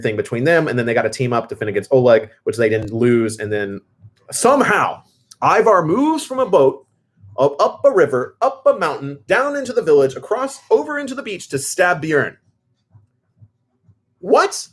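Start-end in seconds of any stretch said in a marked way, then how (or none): 4.3-12.4 incredulous. Real, not acted. Fan retells a ridiculous plot twist, incredulous. 14.2-14.7 high, squeaky disbelieving what; a shocked, sky-high question; baffled what. Real, not acted. Fan baffled by the stab scene, squeaky disbelief. Reacting to the plot.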